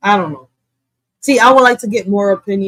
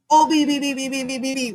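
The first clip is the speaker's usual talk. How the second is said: mock reading; 5 semitones higher, 4 dB quieter, faster than usual. Real, not acted. Streamer mocks the bill acronym, sarcastic letter rattle.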